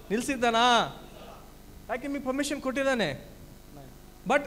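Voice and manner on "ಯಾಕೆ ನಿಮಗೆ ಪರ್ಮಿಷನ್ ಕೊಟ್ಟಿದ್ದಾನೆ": ordinary talk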